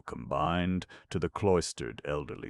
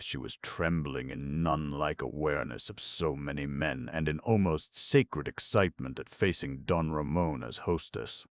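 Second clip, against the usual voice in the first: usual speaking voice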